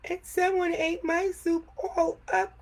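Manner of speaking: put-on baby bear cries that his soup is gone; crying Baby Bear. A narrator's reading. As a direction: wail it like a small bear close to tears. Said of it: "cried Baby Bear".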